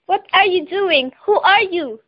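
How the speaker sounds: angry. Acted. Young woman startled by a naked stranger, mildly angry. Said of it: "asked the young woman angrily".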